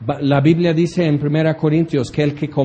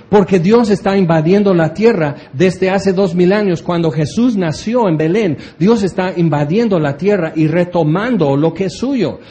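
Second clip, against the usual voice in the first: normal talking voice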